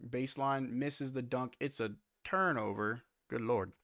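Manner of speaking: brisk live game call; play-by-play. Acted. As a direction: call the action as it happens.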